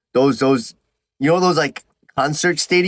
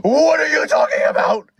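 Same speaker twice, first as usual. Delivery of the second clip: high, exaggerated incredulous cry; mock a commenter's disbelief, pitch way up; imitated baffled commenter; 10 semitones higher than usual. Viewer baffled by his story, high mock-incredulous cry. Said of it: "someone just goes".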